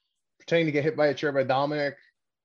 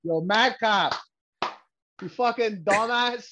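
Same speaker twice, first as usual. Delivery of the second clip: mock heckle; 6 semitones higher than usual. Heckling wrestler as if present.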